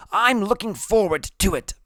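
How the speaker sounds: put-on very high kid voice; high-voiced eager reply; Freak's character voice. A narrator's reading. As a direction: pitch it way up.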